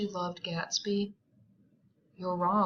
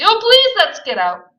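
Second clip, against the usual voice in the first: high, loud pleading; desperate plea; 12 semitones higher, 15 dB louder than usual. Acted. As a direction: beg to leave. Daisy pleading.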